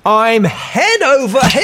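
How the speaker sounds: high, slow, dazed voice of a returned spaceman; returned astronaut. Acted. Astronaut home from space, to his wife, high and slowed down. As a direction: play a spaceman who is high and slowed right down, lost back on Earth. Tim tumbles over.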